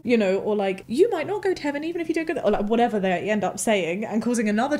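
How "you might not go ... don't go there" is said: high, quick mimicked kid; high-pitched, hurried child voice; child on playground. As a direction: blurt it like a little kid to playmates. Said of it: "my child going around on the playground".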